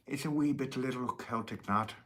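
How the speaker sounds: a jokey fake Irish accent with wee sung out; horrible Irish accent. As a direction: put on a bad stage-Irish lilt for a joke. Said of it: "that's a horrible Irish accent".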